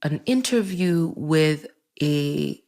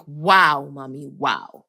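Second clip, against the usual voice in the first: acted disgusted dismay, pitched down; unimpressed disbelief; 5 semitones lower, slower than usual. Daughter watches mother leave the castle, low unimpressed disbelief. Sarcastic reaction to mother leaving.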